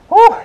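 short loud woo; exertion whoop. Real, not acted. Instructor before one last round, exertion whoop. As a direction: blow off effort with one whoop.

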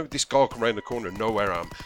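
ordinary talk, nothing marked

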